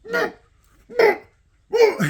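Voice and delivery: high imitated retch; short, loud dry-heave noises; mock retching. Storyteller imitating the ref who saw his wound, retching. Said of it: "He started reaching".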